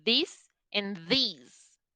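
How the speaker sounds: "this and these" said very slowly, short i then long ee; slow contrast. Shown as a demonstration. Teacher slowly contrasting the two words. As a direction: draw out "this and these" to show the difference. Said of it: "nos diferencia".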